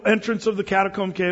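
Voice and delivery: usual speaking voice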